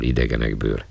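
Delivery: ordinary delivery, nothing marked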